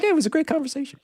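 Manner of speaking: high-pitched mock pleasantry; mock cheery sign-off. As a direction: imitate a chipper goodbye in a high voice. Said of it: "and it's like".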